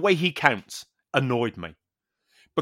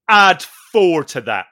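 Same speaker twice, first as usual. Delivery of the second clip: louder, slow smug instruction; mock instructor's slow smug reply; smug louder quote; 8 dB louder, slower than usual. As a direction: say it slowly with smug authority. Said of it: "he just went".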